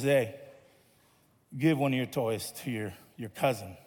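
lightly voiced mom, low and quiet; mom's voice.

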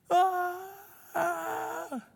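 put-on high wailing cry of a child in pain; imitated wailing scream. Dad imitating his daughter's splinter scream, high and wailing. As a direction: mimic a child's anguished wail. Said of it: "I just heard the screams of a broken soul".

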